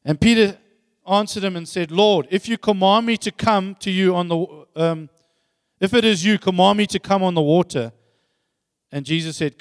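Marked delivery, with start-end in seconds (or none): 0.0-7.9 loud, declaimed Bible verse; scripture reading. Acted. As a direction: read it out boldly to the church. Matthew fourteen twenty-eight.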